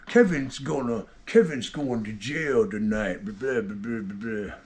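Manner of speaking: sneering parody trailing into blah blah; mocking the uncle. Mocking uncle's Facebook post.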